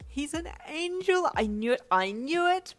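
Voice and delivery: high, loud, stretched-out squeal; ecstatic shouting. A narrator's reading. Boyfriend discovering the baby's wings, ecstatic shout. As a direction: shriek it in triumph.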